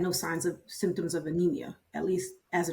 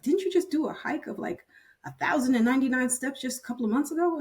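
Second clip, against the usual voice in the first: higher, quick disbelieving question; doubting boss; 6 semitones higher, faster than usual. Retold or imitated. Doctor reminds the nurse about her big hike, higher and doubting. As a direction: press her with the evidence.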